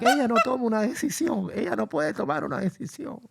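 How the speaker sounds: lightly voiced exasperated dad; imitating dad. Dad complaining his wife won't decide. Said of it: "My dad's like".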